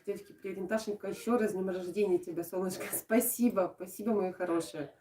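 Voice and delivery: usual speaking voice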